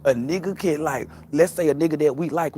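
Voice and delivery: ordinary talk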